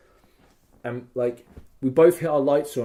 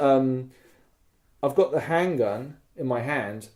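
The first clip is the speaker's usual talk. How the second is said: ordinary talk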